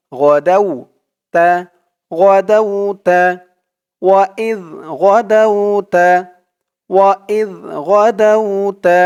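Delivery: slow melodic Quranic recitation. Shown as a demonstration. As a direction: chant the verse with tajweed. (ধীর সুরেলা কুরআন তিলাওয়াত)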